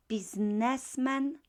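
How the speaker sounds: ordinary talk, nothing marked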